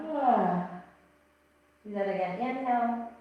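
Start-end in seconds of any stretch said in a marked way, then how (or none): 0.1-0.7 open-mouthed yawn on the exhale; yawning sigh. Shown as a demonstration. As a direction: let the breath out as a big yawn.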